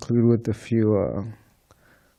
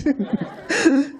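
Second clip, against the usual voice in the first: a sudden high burst of laughter; hearty laugh. Real, not acted. Storyteller laughing at his book pitch, high laugh.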